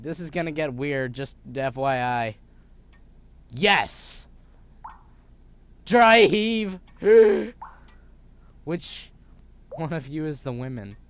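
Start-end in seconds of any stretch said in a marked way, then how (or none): 3.5-4.3 loud defiant yes. Acted. Defiant answer. 5.8-8.1 loud, high dry heave and gasp; disgusted gasp. Acted. Mother reacts in disgust.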